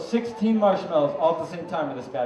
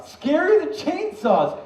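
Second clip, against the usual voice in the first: dramatic build; 11 semitones higher, 3 dB louder, much slower than usual. Acted.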